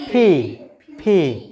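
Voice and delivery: ফি as a "p" with a strong puff of air; aspirated P. Shown as a demonstration. Teacher models letter P, puffed aspirated ফি. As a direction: burst the lips open on ফি, long "ee". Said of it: "এরপরে পিকে কি বলবো?".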